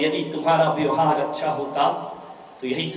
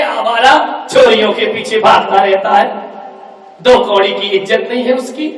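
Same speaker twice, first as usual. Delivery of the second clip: scornful rant; 3 semitones higher, 6 dB louder than usual. Acted. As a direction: sneer at the brother-in-law. Daughter insults brother-in-law.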